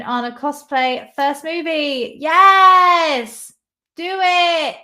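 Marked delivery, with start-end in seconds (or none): 2.2-4.8 loud, high cheer of yes; excited cheer. Real, not acted. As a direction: shout encouragement. Cheering a viewer's cosplay plan.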